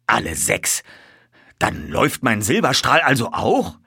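mildly excited colonel; agitated. A narrator's reading. Colonel asking whether his own horse runs, agitated. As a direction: ask with urgent disbelief. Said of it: "fragte der Oberst aufgeregt".

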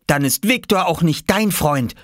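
high-pitched cross retort; mock hiss. Oskar turning on Jule, mildly venomous. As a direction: snipe back like an annoyed child. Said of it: "zischte er Jule an".

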